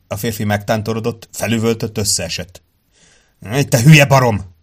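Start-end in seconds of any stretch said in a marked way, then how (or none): 3.7-4.5 loud, angry insult, pitched up; croaked curse. A narrator's reading. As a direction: croak it out furiously at him. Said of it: "krákogta Sloan". (hangosan kikrákogott, dühös szitok)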